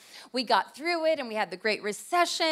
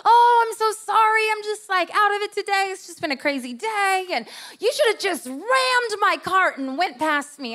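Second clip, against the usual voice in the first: higher, louder flustered apology; imitating frazzled lady; 7 semitones higher, 4 dB louder than usual. Stranger apologizing for blocking the aisle, in a higher, flustered voice. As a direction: gush out the excuse.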